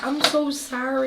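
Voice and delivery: mock contrite sorry, drawn out; sheepish apology. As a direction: apologize like you just got caught. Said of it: "I'm like".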